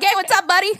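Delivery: loud, high, over-friendly Canadian greeting; hammed-up Canadian buddy voice; mock Canadian accent. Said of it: "What was that accent?".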